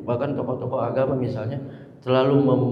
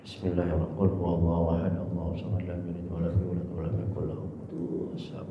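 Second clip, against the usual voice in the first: softly recited Qur'an verse, low and hushed; quiet recitation; 9 semitones lower, 8 dB quieter than usual. Retold or imitated. Preacher reenacting his tawaf prayer, hushed recitation. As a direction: murmur the surah like private prayer at the Ka'bah.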